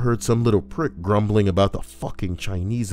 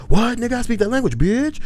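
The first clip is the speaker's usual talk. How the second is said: retold shouted comeback; 7 dB louder than usual. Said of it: "he turned back and he said".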